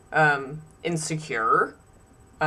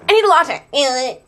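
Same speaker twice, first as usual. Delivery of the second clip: mock rude customer; 5 semitones higher, 4 dB louder than usual.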